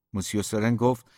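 ordinary delivery, nothing marked

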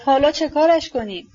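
high, alarmed outcry; cry out. A narrator's reading. Lizaveta clutching the weeping boy, a high alarmed cry. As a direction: call out in helpless alarm, pitched high. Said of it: "فریاد زد".

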